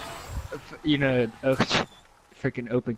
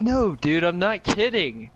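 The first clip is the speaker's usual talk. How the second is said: slow, higher-pitched insisting; earnest insistence; 6 semitones higher, 3 dB louder, slower than usual. Acted. Friend insisting the zombies are real, earnest. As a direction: insist with drawn-out words. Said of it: "Wait, no, you're like".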